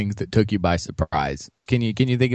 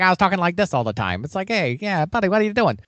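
put-on higher-pitched young New York actor; Al Pacino; 6 semitones higher than usual. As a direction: imitate the star's early, higher New York voice. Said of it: "If you look back at Dog Day Afternoon".

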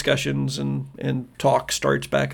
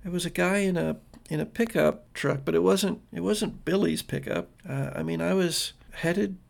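put-on hesitant, slightly raised girl's voice; confused, near tears; 3 semitones higher, 3 dB quieter than usual. Confused girl explains the pickup ride, faltering. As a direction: falter softly through a muddled memory. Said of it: "She looks confused".

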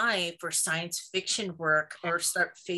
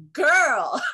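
put-on loud, high Jamaican "Girl" with a cry; imitating a Jamaican reader's excited shout; Jamaican accent; 8 semitones higher, 9 dB louder than usual. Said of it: "she has this heavy Jamaican accent".

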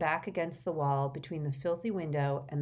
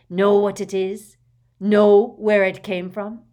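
much louder, insistent questions; loud boastful demand; 3 semitones higher, 13 dB louder than usual. A narrator's reading. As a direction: thrust the question at him forcefully. Father brandishing ring, demanding recognition.

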